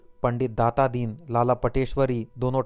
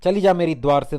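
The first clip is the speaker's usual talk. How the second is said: full-throated yell, higher and louder; man yelling at his sister-in-law; shouting; 5 semitones higher, 8 dB louder than usual. A narrator's reading. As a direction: bellow her out the door. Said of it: "गला फाड़ कर बोला".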